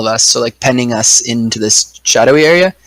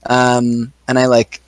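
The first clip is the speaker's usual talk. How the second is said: ordinary talk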